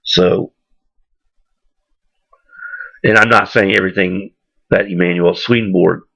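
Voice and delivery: ordinary talk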